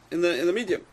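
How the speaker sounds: usual speaking voice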